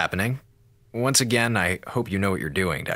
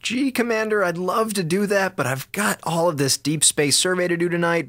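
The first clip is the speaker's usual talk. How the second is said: high, gushing mock enthusiasm; fake eagerness; 9 semitones higher than usual. Eiffel dodging the commander's order, gushing and high. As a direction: oversell it like a keen schoolboy.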